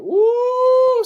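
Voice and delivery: long, loud, high-pitched woo; high whooping "woo". Real, not acted. Host reacting to a risqué joke, high whoop. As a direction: let out a sustained high whoop.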